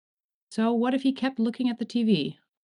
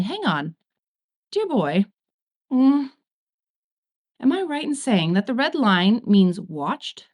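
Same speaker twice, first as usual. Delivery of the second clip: dangerously casual; 3 semitones higher, slower than usual. Acted. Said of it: "in a dangerously casual tone".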